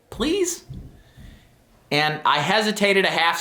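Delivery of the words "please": put-on high, begging little girl's please; pleading child. Daughter begging dad on the stairs, very high pleading. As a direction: plead sweetly like a small kid.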